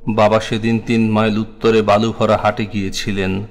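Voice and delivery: usual speaking voice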